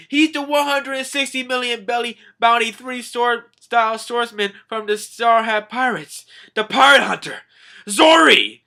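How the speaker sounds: high, loud breathless announcement; mock alarmed proclamation; Neptune yelling. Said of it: "Neptune's yelling through the, yelling like".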